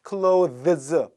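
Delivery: clothes slowly, with th and z both sounded; difficult full pronunciation. Shown as a demonstration. Teacher showing why it is hard, clothes with th kept. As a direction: stretch clothes into "klo-thuhz". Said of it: "발음 어렵죠?".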